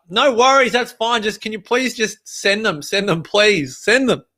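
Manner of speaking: high-pitched, insistent begging; polite but pressing plea; urgent pleading. Retold or imitated. Pleading with courier on phone.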